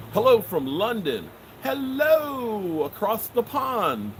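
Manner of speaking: high, drawn-out mock London greeting; hammed-up, badly faked British accent; terrible British accent. Host greets a London viewer, bad mock British accent. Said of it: "That was a terrible accent from across the pond".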